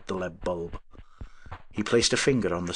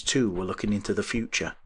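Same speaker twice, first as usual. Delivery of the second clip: usual speaking voice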